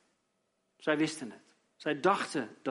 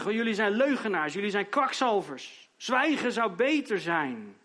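loud, high angry retort; Job scolding back; 5 semitones higher, 4 dB louder than usual. Acted. Job scolds his lying friends, loud and angry. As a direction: hurl the insults back at them. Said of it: "Job die scheldt terug".

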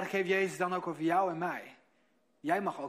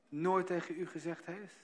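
ordinary delivery

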